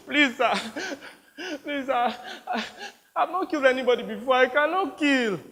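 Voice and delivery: high, panicked begging; desperate pleading boy. Acted. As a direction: plead as a terrified child. Captive boy begs warlord.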